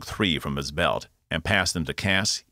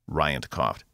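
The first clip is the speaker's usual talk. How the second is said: plain reading voice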